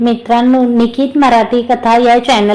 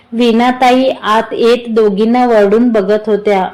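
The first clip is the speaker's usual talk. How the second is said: usual speaking voice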